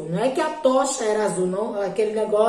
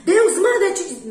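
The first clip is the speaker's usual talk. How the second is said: much higher, louder mock pronouncement; imitating pushy believer; 8 semitones higher, 5 dB louder than usual. A pushy believer delivering God's message, imitated higher and louder. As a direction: lay on a pompous churchy tone. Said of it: "tem crente que fala com você assim".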